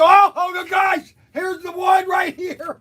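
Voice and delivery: high-pitched squeal of discovery; excited outburst. Real, not acted. Vlogger spotting the car he wanted, excited outburst. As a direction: burst out as you spot the prize. Spots another prized car.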